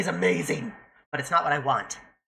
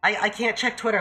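usual speaking voice

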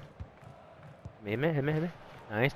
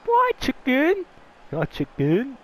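taunting. Real, not acted. Taunting in game.

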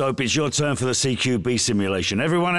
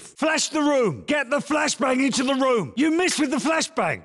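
shouted orders; 11 semitones higher than usual. Acted. Yelling drill corrections.

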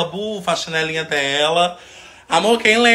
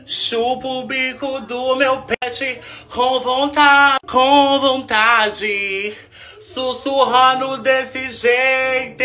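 high, very stretched-out words; slow, high-pitched mock crooning; teasing drawl; 8 semitones higher, much slower than usual. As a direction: drag each phrase out high to goad the dog. Teases dog in drawn-out voice.

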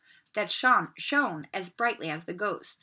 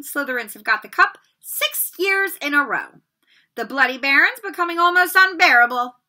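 put-on higher, louder rallying old ghost; the house ghost urging them to win the cup; hearty ghost; 4 semitones higher, 9 dB louder than usual. A narrator's reading. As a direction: rouse the new students. Nick's speech to newcomers.

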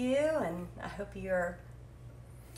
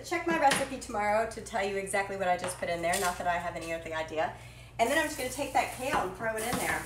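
normal talking voice

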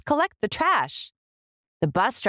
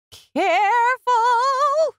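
much higher, slow, loud careful; long warning call; 4 dB louder, much slower than usual. Acted. Foreman warning the crane crew, loud. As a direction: call out a warning across the site.

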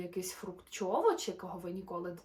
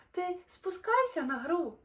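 calling out; 8 semitones higher, 5 dB louder than usual. Acted. Dingo shouts to Kevin.

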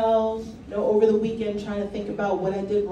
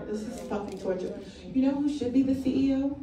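ordinary delivery

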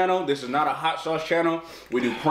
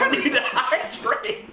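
laughing; 9 semitones higher, much slower than usual. Real, not acted. Laughing after the challenge.

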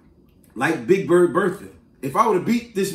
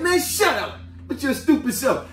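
higher-pitched schoolboy put-down; immature retort; 6 semitones higher than usual. Retold or imitated. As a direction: imitate a kid talking back. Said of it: "we acting immature".

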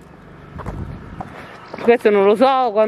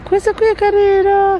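excited; 10 semitones higher, 5 dB louder than usual. Real, not acted. Sudden high delighted exclamation.

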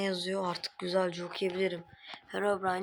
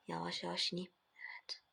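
whispering while hiding; 9 dB quieter than usual. Acted. Sneaking down quietly.

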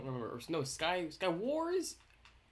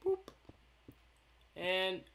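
ordinary talk, nothing marked

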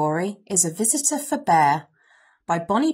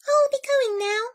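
high, polite little voice; apologetic mouse. A narrator's reading. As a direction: squeak a meek apology. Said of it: "said the Mouse".

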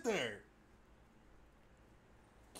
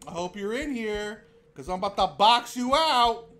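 ordinary delivery

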